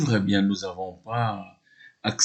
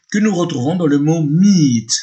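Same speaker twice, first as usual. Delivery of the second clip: emphatic teacher; 7 semitones higher, 8 dB louder than usual. Shown as a demonstration. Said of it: "le phonème I".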